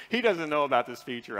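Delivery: usual speaking voice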